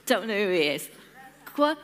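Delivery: ordinary delivery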